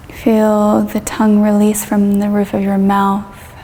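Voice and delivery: calm yoga teacher leading a meditation; guided meditation. Acted. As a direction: ease the listener down through the body, unhurried. Leads a guided body-scan meditation.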